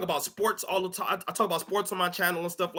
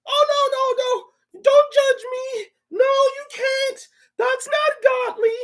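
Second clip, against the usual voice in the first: drawn-out falsetto pleading; mock panicked sinner; 4 dB louder, slower than usual. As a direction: protest slowly in a very high voice. Mocks the sinner once exposed.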